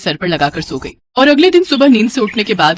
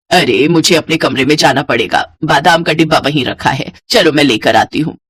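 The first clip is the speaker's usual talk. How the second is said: lightly voiced mother-in-law; 6 semitones lower than usual. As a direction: lower mother-in-law voice, mildly grumbling.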